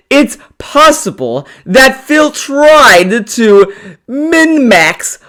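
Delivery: high, drawn-out put-on read-out. Streamer reading an opinion on Phil, high put-on voice. Reads a comment aloud.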